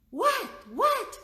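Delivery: high, loud bewildered what, what; baffled what. Retold or imitated. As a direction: squeak out confused whats at her tears. Bewildered mother reacting.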